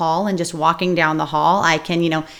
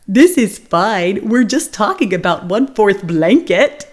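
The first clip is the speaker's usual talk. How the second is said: slow, funny camel voice, a bit louder; funny storybook camel voice to cheer up a child; Humphrey voice; 4 dB louder than usual. Retold or imitated. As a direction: drawl it out like a silly cartoon camel. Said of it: "I turned into Humphrey and I said".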